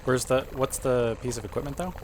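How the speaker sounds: normal talking voice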